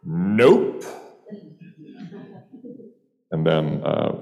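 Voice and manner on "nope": higher, blurted one-word refusal; edge in her voice. Retold or imitated. Bartender turning down a martini order, curt and edgy. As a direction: shut the request down with an edge. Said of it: "blurted out with an edge to her voice".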